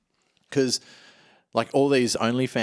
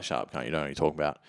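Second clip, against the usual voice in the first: fast, low, brushing-off retort; dismissive gangster; 5 semitones lower, 4 dB quieter, much faster than usual. Retold or imitated. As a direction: rattle it off like a tough guy. Gangster quote, quick dismissive retort.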